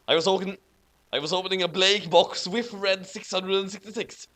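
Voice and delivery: usual speaking voice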